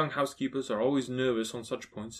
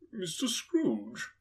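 slow, higher questioning voice; astonished gentleman; 5 semitones higher, 4 dB quieter, much slower than usual. A narrator's reading. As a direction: stretch the name in disbelief. Astonished gentleman recognises Scrooge.

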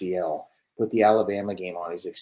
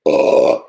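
groan; 6 semitones lower, 14 dB louder than usual. Real, not acted. Disgust at coaches cussing.

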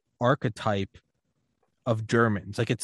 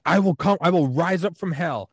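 high, louder mock threat; stuffy German father; 5 semitones higher, 3 dB louder than usual. Stuffy German father threatening his son, raised mock yell. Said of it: "this stuffy German dude".

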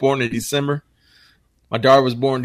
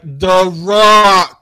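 "The Rock" louder, stubborn dad correcting back; mock insistent; 5 semitones higher, 10 dB louder than usual. Dad teasing his daughter, louder stubborn "The Rock". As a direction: plant the name firmly and loud. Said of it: "I'm like".

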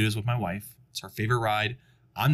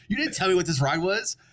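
retold yelling; 7 semitones higher than usual. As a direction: imitate a wife telling off her husband. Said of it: "She starts yelling at her husband".

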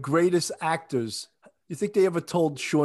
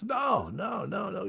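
higher-pitched imitation of a dismissive professor; mock dismissal; 6 semitones higher, 7 dB quieter, slower than usual. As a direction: wave it away like a professor, trailing softer. Retold professor rejects the answer.

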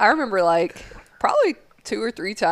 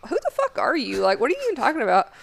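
incredulous retort; 6 semitones higher, faster than usual. Retold or imitated. Retorting to the instructor.

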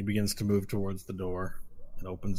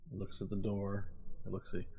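normal talking voice

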